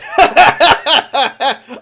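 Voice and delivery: loud, high belly laugh; mocking laugh. Shopkeeper laughs at the tripped man, loud mocking laugh. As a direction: laugh at the fool who tripped.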